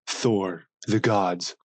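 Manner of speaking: usual speaking voice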